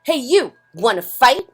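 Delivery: high, loud cocky taunt; grouchy ladybug challenge. Acted. Grouchy ladybug challenging a yellow jacket, high and loud. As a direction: call out a fight, brash.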